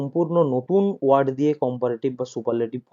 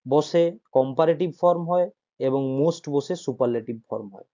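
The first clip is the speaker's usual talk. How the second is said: ordinary delivery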